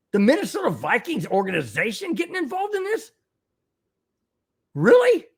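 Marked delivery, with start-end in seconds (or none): 2.1-5.2 incredulous. Real, not acted. Shocked at the Vikings' involvement.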